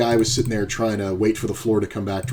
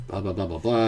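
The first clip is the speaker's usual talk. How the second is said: mock mumbling; 2 semitones lower, 5 dB quieter than usual. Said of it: "and he goes".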